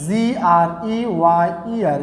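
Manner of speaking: slow, letter-by-letter spelling. Shown as a demonstration. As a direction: spell it out slowly for the class. Spells the word for students. (ধীরে ধীরে বানান করে)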